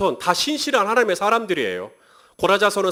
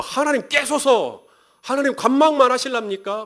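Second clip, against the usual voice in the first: defiant plea; 6 semitones higher, 4 dB louder than usual. Acted. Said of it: "막 하나님께 대들어도 되나".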